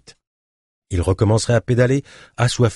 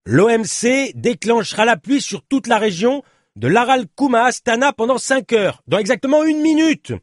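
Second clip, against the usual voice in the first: high, urgent proclamation; shouted announcement; 3 dB louder than usual. Acted. Journalist announcing rescue rain to his companion, urgent shout. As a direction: call out the forecast, building to the end.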